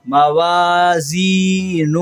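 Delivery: very slow drawn-out recitation. Shown as a demonstration. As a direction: stretch every syllable like a tajweed teacher. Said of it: "اس طرح۔". (آہستہ کھینچ کر تلاوت)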